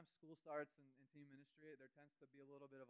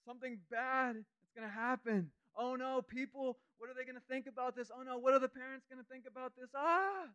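much higher and louder, frantic; panicked fear; 11 semitones higher, 16 dB louder than usual. Retold or imitated. Pastor mocking his old fearful self, high and panicked. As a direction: squeal it out like a panicking worrier. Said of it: "when I used to get a fear like".

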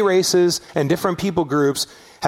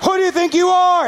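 furious shout chasing the car; angry yelling; 12 semitones higher than usual. Retold or imitated.

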